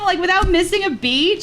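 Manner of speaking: usual speaking voice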